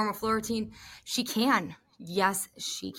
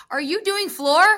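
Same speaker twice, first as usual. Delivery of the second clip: higher, louder question; loud calling out; 7 semitones higher, 8 dB louder than usual. Retold or imitated. Commentator calling to a gymnast across the way, loud. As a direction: call it across the room. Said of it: "I said it very loud across the way".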